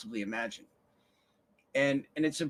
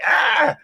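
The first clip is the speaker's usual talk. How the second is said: exasperated yell; 13 semitones higher, 11 dB louder than usual. Retold or imitated. Mock exasperated outburst.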